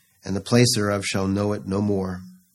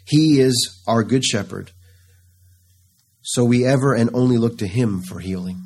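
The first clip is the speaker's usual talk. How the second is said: usual speaking voice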